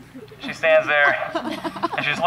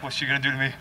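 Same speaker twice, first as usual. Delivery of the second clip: hushed, low, uneasy aside; acted quiet unease; 6 semitones lower, 8 dB quieter, much faster than usual. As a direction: mutter it softly as if nervous of her. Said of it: "he's kind of uneasy".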